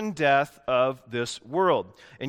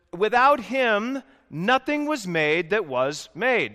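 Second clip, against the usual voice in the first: "Without him" high, loud and slow, then "nothing was made" pressed out; driving it home; 6 semitones higher, 6 dB louder, slower than usual. Shown as a demonstration. Preacher driving home the point to the pews, slow forceful emphasis. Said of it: "John wants to drive the point home".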